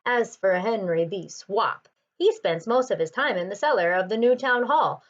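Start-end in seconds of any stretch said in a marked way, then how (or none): none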